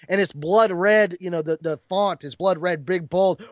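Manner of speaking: ordinary talk, nothing marked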